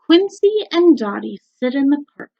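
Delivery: normal talking voice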